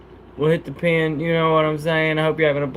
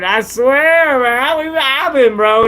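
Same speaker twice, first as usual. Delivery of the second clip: insisting; 9 semitones higher, 4 dB louder, slower than usual. Real, not acted. Insisting against haters.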